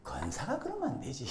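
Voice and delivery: soft, low mock reproach; chiding daughter. Daughter to her cross mother, soft chiding. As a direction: lightly imitate a daughter needling her mother. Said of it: "딸이 하는 말".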